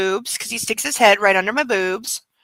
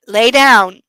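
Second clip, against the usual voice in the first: firm command; 7 dB louder than usual. Real, not acted. Commanding the dog to lie down.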